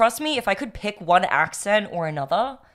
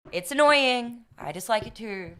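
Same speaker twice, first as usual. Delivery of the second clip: ordinary talk, nothing marked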